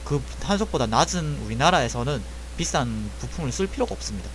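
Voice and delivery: normal talking voice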